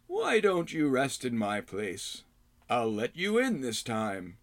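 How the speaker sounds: put-on slow gentle old hermit; kindly old man. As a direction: offer shelter, slow and kindly. Rydoth offers rest.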